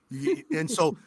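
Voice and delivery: ordinary delivery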